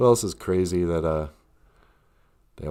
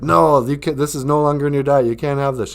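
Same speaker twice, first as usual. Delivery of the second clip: scolding trainer; 5 semitones higher, 5 dB louder than usual. Retold or imitated. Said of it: "And she's like".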